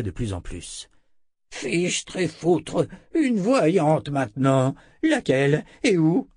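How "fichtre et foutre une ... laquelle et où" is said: slow, high exasperated outburst; exaspération. A narrator's reading. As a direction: burst out, stretching each word in exasperation. Z exaspéré par la nouvelle. (emporté et exaspéré, voix aiguë)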